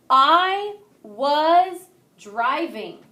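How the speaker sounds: slow, loud, each word laid out for the class; slow model sentence. Shown as a demonstration. Teacher modelling an example for the Spanish class, slow and loud. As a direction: spell the example out slowly for students. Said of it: "you're gonna need to use the imperfect".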